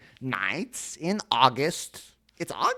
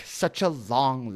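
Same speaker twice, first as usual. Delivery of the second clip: ordinary delivery, nothing marked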